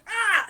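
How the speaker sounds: high loud yelp of a falling enemy. Acted. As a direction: cry out as you tumble. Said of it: "you hear someone go".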